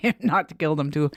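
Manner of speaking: ordinary talk, nothing marked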